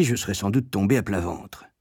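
ordinary delivery

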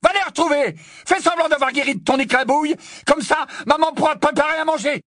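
high, loud, mocking tirade; roaring. A narrator's reading. Harry taunts Ron to run home to mother, roaring. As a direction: shout jeering taunts at him. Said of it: "rugit Harry".

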